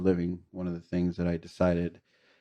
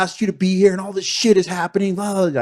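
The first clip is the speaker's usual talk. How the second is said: much higher, loud, raw furious yell; screaming and cussing at God; 13 semitones higher, 6 dB louder than usual. Retold or imitated. Alone on the mountain, yelling at God, furious screaming. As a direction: scream it at the sky like you've been abandoned. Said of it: "I was screaming and cussing".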